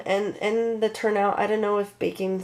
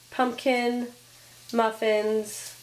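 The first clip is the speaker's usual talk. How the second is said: normal talking voice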